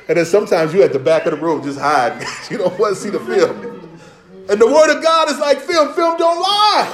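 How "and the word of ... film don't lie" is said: high, ringing preacher's exclamation; preaching cry. Real, not acted. Preacher likening God's word to game film, high exclamation. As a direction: hold the last word up high. Preacher drives home his punchline.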